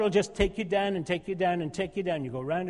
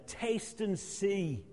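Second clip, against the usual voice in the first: slow savouring; 5 dB quieter, much slower than usual. Acted. Savouring scripture phrase.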